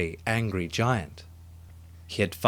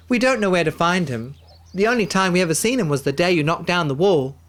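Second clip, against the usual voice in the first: put-on high, louder, quicker childlike voices; children's voices; 8 semitones higher, 5 dB louder, faster than usual. Children answering the giant's question. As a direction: answer eagerly like small children.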